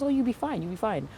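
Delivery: high, light soothing voice; imitated breezy reassurance. Mother mimicking the reassurance given before labor, high soothing voice. Voicing the reassuring hospital staff.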